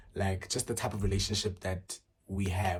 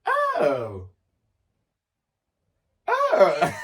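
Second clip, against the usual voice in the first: high loud shocked gasp of oh; 9 semitones higher, 11 dB louder than usual. Real, not acted. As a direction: caught off guard by the question. Reacting to a shocking question.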